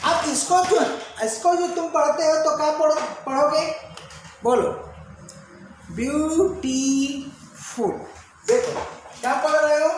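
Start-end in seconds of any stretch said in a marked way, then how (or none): 5.9-7.9 ब्यूटीफुल। slowly split as byoo, tee, ful; syllable by syllable. Shown as a demonstration. Teacher showing several mouth movements, ब्यूटीफुल। in slow separate syllables. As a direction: stretch each of the three syllables of ब्यूटीफुल।. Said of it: "तो कई बार हलचल हो गई".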